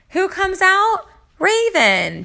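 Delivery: high, loud build to the surprise name; spring the name on us like a reveal; excited reveal. Real, not acted. Vlogger telling viewers who shows up, excited reveal.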